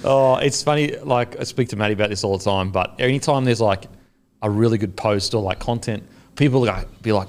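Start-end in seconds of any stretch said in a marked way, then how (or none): none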